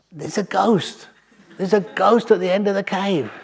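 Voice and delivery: retold terrified cry. Villagers spot a ghost in the cave, mock-frightened cry. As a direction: imitate scared villagers raising the alarm. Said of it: "so terrified of ghosts".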